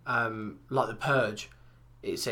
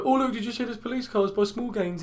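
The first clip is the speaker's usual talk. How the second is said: nosy gossip; 9 semitones higher than usual. Retold or imitated. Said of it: "they go".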